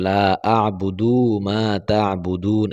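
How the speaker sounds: লা আ'বুদু মা তা'বুদুন said slowly with বুদু drawn out too long; mock-wrong আ'বুদু with a long বুদু; wrongly stretched vowel. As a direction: imitate the common error of lengthening বুদু in আ'বুদু. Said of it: "বুদু যেন টান না হয়ে যায়".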